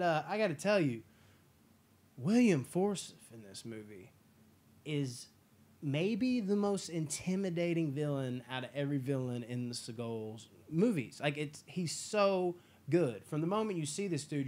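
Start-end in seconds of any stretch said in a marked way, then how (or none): none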